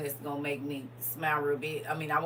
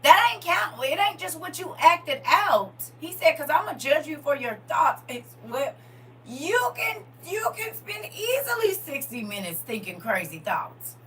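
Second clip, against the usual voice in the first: preaching; 9 semitones higher, 9 dB louder than usual. Acted. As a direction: drive it home to the congregation. Preaching at full force.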